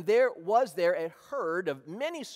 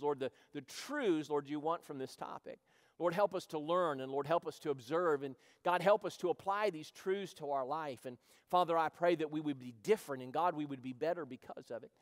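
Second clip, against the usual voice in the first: softer, lower earnest prayer voice; 3 semitones lower, 3 dB quieter than usual. Real, not acted. Pastor praying before the Bible lesson, soft prayer. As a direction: pray humbly over the room.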